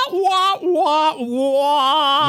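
high, muffled wah wah talk; sad trombone wah wah. Acted. Mocking sad trombone after moving on.

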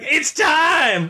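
high excited cheer. Retold or imitated. Fan retelling his cheer at the monster movie, high and excited. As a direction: whoop like a fan in the theater. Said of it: "I cheered out loud".

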